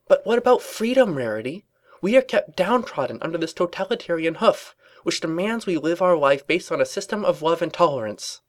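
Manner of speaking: high, loud indignant speech; fervent protest. A narrator's reading. Twilight spills sedition to Rarity, fervent and loud. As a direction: declaim it like a budding rebel. Character rants about freedom.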